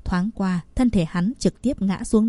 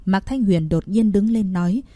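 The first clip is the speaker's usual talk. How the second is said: usual speaking voice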